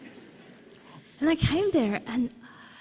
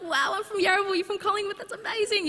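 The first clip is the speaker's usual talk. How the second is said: much higher, gushing; excited; 11 semitones higher than usual. Retold or imitated. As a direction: chatter delightedly about home suburbs. Excited chat with fellow Melburnian.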